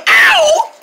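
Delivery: loud high yelp of pain. Acted. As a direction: yelp as the finger hits. Hurt finger again.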